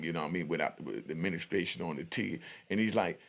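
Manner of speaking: ordinary delivery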